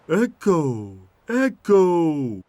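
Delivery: high, loud drawn-out calls; yelling. Acted. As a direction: yell out to wake the echo. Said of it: "yelled Tommy the trailer".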